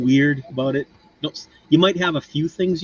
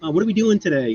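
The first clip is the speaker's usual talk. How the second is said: higher-pitched chirpy greeting in imitation; Mila Kunis; 8 semitones higher than usual. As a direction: lightly imitate a gamer girl saying hello. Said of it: "and she's like".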